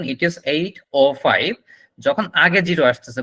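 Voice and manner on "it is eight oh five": slow model sentence; demonstrated time phrase. Shown as a demonstration. Teacher giving the rule for times, slow demo. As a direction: spell out the time slowly, "oh" for zero. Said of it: "এটা বলবেন".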